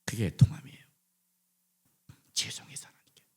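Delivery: awed, breathy hush over the congregation; hushed whisper. Acted. Preacher drops to a whisper.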